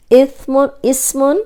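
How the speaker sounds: اسمن with a light whistling س; ismun with seen. Shown as a demonstration. Teacher warning of a mistake, a whistling س. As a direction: say اسمن with a thin hiss. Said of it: "سین سیٹی والا ہے".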